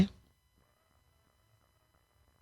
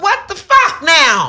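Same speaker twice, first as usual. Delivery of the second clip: higher, slow, exasperated demand; retold outraged cry; 9 semitones higher, slower than usual. Cursing God at the beach, exasperated and slow. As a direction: throw it at the sky in disbelief. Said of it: "I went".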